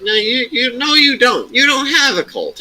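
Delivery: high, insistent no, arguing back; exasperated denial. Retold or imitated. Retelling an argument with a friend who bragged about a cult, exasperated high insistence. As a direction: shoot him down in a high, exasperated voice.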